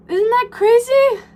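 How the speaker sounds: high, loud, slow amazed exclaim; thrilled squeal of delight at her own pottery; excited. Real, not acted. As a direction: gush over the piece in real excitement. Showing off salt-fired pieces.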